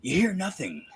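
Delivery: lightly imitating Shatner, halting and dramatic, fading to almost nothing; a hint of Shatner's clipped pauses, dying away at the end; Shatner impression. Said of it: "That was so Shatner".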